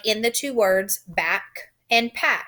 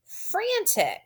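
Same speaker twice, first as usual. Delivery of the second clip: word of the day; 11 semitones higher, 4 dB quieter than usual. Shown as a demonstration. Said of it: "Today, our new word is".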